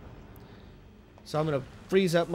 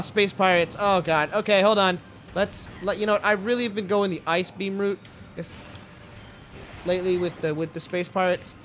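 normal talking voice

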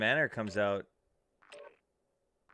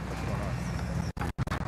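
audio dropping out; 4 semitones lower, much slower than usual. Real, not acted. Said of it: "My audio keeps cutting out".

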